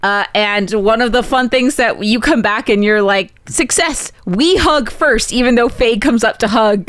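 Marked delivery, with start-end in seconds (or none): 3.5-4.1 triumphant success. Retold or imitated. Elliot returning triumphant.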